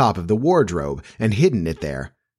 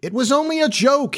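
high-pitched, understated roar. A narrator's reading. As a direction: protest in indignant outburst. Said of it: "he roared".

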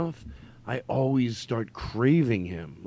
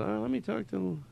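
imitated hedging, higher and softer; Norm Macdonald; 9 semitones higher, 8 dB quieter than usual. Comedian dodging an invitation out, soft high impression. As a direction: evasive comic, lightly voiced. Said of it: "He goes".

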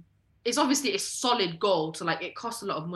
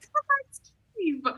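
high-pitched exasperated shout; retold shouting; 14 semitones higher, much slower than usual. Telling how she shouted at him on the plane, high shout. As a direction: shout it high, drawing the words out. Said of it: "just shouting at him".

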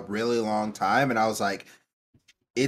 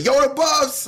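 high, loud excited cry; mock excited yell; 12 semitones higher, 6 dB louder, slower than usual. Acting out someone's reaction to the buffs, hyped yell. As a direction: burst out like a hyped-up fan.

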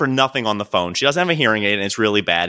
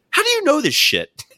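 amazed; 13 semitones higher than usual. Retold or imitated. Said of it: "New Ron looks at me and goes".

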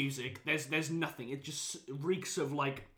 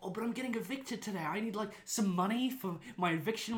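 begging woman's voice; 6 semitones higher than usual. Retold or imitated. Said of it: "She's like".

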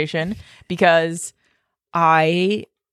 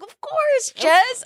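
mock incredulous husband; much slower than usual. Imitating husband, high pitched.

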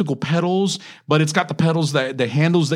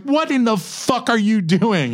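mock angry wife; 7 semitones higher, slower than usual. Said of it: "your wife is like".

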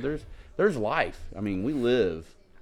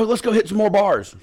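high, louder rallying call; rally your buddy for more bars; hammed-up excited drunk; pumped up; 12 semitones higher, 6 dB louder than usual. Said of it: "So they're like".

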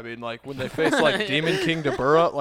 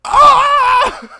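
very high, loud sustained scream; hammed-up shriek of being blasted; mock death scream; 11 dB louder than usual. Fleeing character gets obliterated, mock scream. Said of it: "and they're just".

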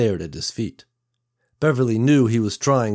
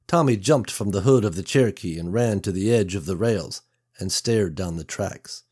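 plain reading voice

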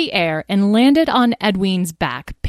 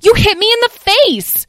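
high, loud outraged cry; young dragon crying out after a tail smack; 10 dB louder than usual. Acted. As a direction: wail it out, offended. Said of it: "cried Edwin".

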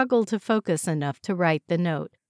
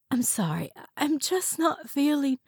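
faint and unwell; 8 semitones higher than usual. A narrator's reading. Joyce fading, unwell.

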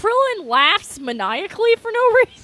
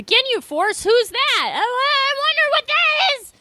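mock excitement; 12 semitones higher than usual.

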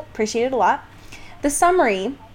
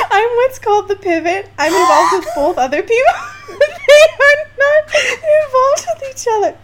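high, loud, breaking into laughter; crying with laughter; 12 semitones higher, 5 dB louder than usual. Real, not acted. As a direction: read through helpless giggles. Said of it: "I'm literally sobbing right now".

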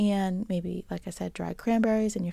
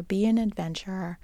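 ordinary delivery

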